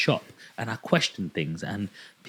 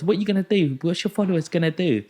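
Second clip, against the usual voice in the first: higher, louder sneering challenge; mock taunt; 9 semitones higher, 5 dB louder, faster than usual. Shop manager mocking a customer's followers, taunting. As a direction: needle him, pitched up and loud.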